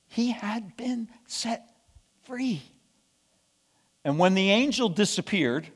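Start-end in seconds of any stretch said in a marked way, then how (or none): 0.1-2.6 soft, high, slow and whispery; near-whispered wonder, drawn out; hushed awe. A narrator's reading. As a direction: let each word land softly, slowly. Awed climax of the escape story.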